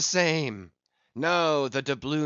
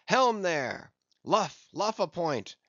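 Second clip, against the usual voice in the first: raised, louder steering command; calling to the helm; 6 semitones higher, 4 dB louder, slower than usual. A narrator's reading. Captain ordering the helmsman to luff, calling out. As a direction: hail the helmsman sharply from aloft.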